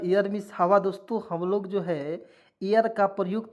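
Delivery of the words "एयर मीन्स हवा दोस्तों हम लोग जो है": normal talking voice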